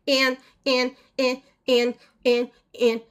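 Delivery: gently mimicked stuck-on-and stutter; imitating his stutter. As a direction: repeat the stuck word like a child caught in a stutter. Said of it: "stuck in this stutter loop".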